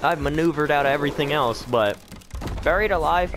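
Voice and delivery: fast, high, loud frantic protest; frantic disbelief. Real, not acted. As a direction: rant in rising panic. Being buried alive in game.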